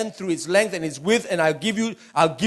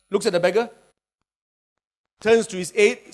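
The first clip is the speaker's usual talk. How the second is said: usual speaking voice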